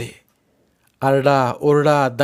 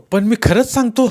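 pleading; 10 semitones higher, 5 dB louder than usual. Acted. Bhagwanrao pleads.

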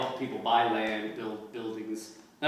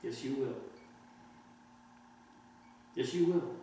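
soothing reassurance; 10 dB quieter than usual. Acted. Man soothes limp girl.

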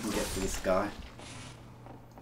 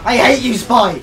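angry shout; 11 semitones higher, 16 dB louder than usual. Real, not acted. Killed by enemy Spy.

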